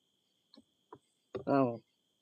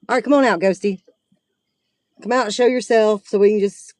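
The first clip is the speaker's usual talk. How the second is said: calling out; 4 semitones higher, 5 dB louder than usual. Real, not acted. Calling out to the ghost.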